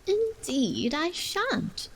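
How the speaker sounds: Alice impatiently refusing, slow and high. A narrator's reading. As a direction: refuse with a huff. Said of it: "Alice said rather impatiently".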